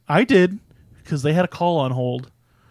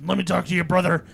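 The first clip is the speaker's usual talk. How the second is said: Hulk Hogan impression; 6 semitones higher than usual. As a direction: bellow it like a pumped-up wrestler. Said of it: "And Hulk Hogan was like".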